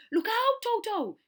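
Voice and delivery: very high, loud warning shout; urgent shout. Acted. Hippo warns the boy, shouting very high and loud. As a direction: yell to save the children. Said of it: "shouted Humpy Rumpy".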